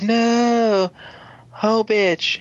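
high, wailing mock cry of loss; mock anguished cry. Hero watching friend get taken, high mock wail. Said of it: "and Ian's like".

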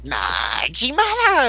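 high, slow, stretched-out announcement; mock transformation call. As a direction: draw it out like a triumphant roll call.

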